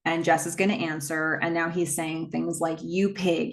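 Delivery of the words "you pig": usual speaking voice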